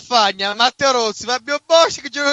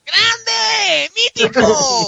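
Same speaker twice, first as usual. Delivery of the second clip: excited shout; 12 semitones higher, much slower than usual. Retold or imitated. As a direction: cheer like a thrilled fan. Cheering a guest's name on air.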